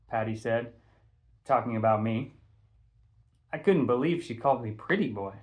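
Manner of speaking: ordinary delivery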